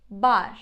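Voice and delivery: crisp, loud Turkish "Bar", no American R. Shown as a demonstration. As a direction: say "Bar" firmly, R light and Turkish. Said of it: "we would pronounce it like that".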